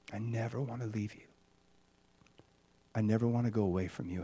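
soft devoted whispered prayer; tender prayer. Retold or imitated. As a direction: pray it close and intimate. Intimate retold prayer to God.

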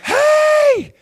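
very high, loud imitation of a boy; calling out. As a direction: yell from afar like a little kid. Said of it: "he, like, calls to me".

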